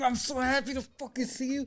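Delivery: high, soft, gushy greeting; mock gushing. Mimicking people who always greet warmly, mock gushing. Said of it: "every time you see 'em, they're just like".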